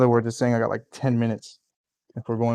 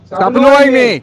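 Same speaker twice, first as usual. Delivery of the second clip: loud, high playful yell; mock angry shout; 11 semitones higher, 11 dB louder than usual. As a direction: yell it in fun at a friend. [name] yells back at Lewis.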